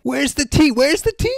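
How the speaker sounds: high-pitched, baffled heckle; mock incredulity. Real, not acted. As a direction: squeal the question in mock outrage. Mock outrage at dropped T.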